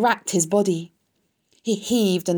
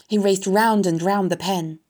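ordinary delivery, nothing marked

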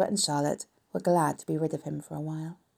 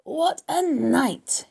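very slow, high swooping hoarse cry; hoarse repeat; 8 semitones higher, 4 dB louder, much slower than usual. A narrator's reading. Gorged rat relives his night, hoarse and very drawn out. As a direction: savour each word hoarsely, stretched long. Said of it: "he repeated hoarsely".

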